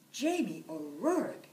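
wife telling her husband off; furious. Acted. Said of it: "she was furious".

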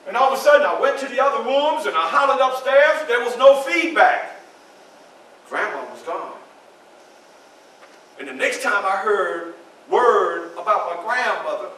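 normal talking voice

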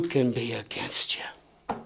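slow, near-whispered rhetorical question; hushed preaching. Real, not acted. Preacher assuring the congregation God is for them, hushed. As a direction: drop to a slow, breathy hush. Hushed close of scripture line.

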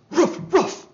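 high, loud ruff ruff bark; dog bark. Acted. Man's dog answering the bartender, barking loud.

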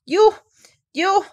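high, loud, broken-up accusation; indignant, halting high outburst; spluttering. A narrator's reading. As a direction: splutter it out with false starts. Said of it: "spluttered Piglet".

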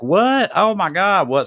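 high-pitched mock disbelief; astonished. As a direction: quote an amazed reaction, voice leaping up. Acting out astonished fellow comic.

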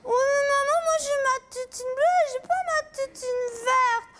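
put-on high whimpering little girl; whiny child. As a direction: whimper and plead like a tired kid. Child whimpering, calling mother. (voix d'enfant pleurnicharde)

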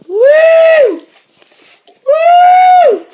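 high loud whoop; triumphant cheer; victory whoop. Acted.

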